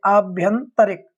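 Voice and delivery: आभ्यंतरिक said slowly and clearly, with आ at the start; slow correct word. Shown as a demonstration. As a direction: spell out आभ्यंतरिक carefully with आ. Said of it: "अ की जगह आ होता है". (आभ्यंतरिक धीरे, साफ़ आ के साथ)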